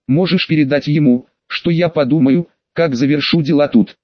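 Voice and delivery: ordinary delivery, nothing marked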